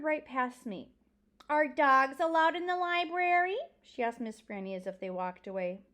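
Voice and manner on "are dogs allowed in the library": loud, high, pointed question; pointed disapproval. Acted. Pinch-faced girl asking the librarian about the dog, pointedly disapproving. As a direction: ask it loudly as an objection.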